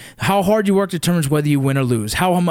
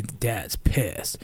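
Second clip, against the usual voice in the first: much softer, low hushed aside; hushed kid's remark; 8 semitones lower, 9 dB quieter than usual. Retold or imitated. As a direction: say it like a kid telling his brother a secret. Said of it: "heard my oldest one talk to the youngest one and say".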